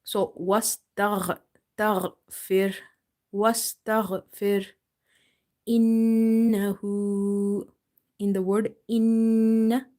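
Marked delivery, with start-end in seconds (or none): 0.0-4.7 wastaghfir with a full-mouth throaty gh; slow, heavy gh from the top of the throat; heavy ghain. Shown as a demonstration. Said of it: "ghain is from the top of your throat". 5.7-7.7 innahu very slow with held nasal nn; innahu, stretched nasal nn; nasal ghunna. Shown as a demonstration. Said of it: "you stretch up to one alif".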